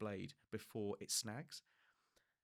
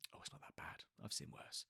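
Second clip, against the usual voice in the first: soft whisper, unimpressed; hushed offhand whisper; whispered dismissal; 8 dB quieter than usual. Retold or imitated. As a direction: shrug it off quietly. Owner brushing off blister.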